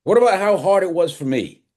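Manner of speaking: high, loud cry of hurt; anguished outburst. Acted. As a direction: let the pain burst out at him. Father's anguished outburst at son.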